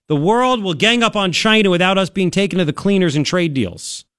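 ordinary delivery

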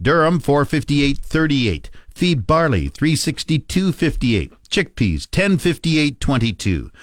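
ordinary talk

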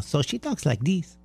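lightly voiced female dog, soft; hint of a girly dog character. Shown as a demonstration. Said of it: "Except for Tessa, she's a female".

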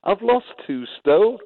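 loud, high-pitched mock protest; high, amused comeback; retold laughing protest. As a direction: protest it loudly, as a retold joke. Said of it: "he starts laughing and says".

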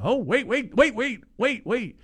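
high, rapid-fire repeated waits; high-pitched retold disbelief; retold sudden alarm. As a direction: stammer it out high, like a startled fan. Acting out sudden shock at play.

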